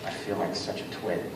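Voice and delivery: soft, low, contrite; sheepish apology. Retold or imitated. Actor owning up to his director about the injury, sheepish.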